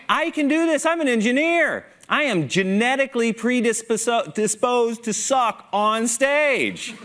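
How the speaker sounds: high-pitched, emphatic comic outburst; mock protest. Engineer pleading his case to the audience, mock protest. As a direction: protest it high and worked-up, stretching the end.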